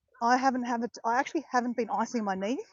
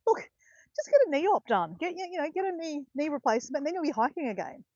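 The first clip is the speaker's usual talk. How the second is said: higher, breezy voice of a physio; raised, lively quoting voice; retold physio advice; 7 semitones higher than usual. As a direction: voice a physio airily brushing it off, pitched up. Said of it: "the physio said".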